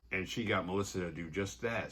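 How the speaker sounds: ordinary talk, nothing marked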